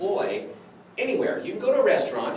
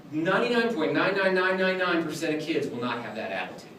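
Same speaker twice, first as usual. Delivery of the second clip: ordinary delivery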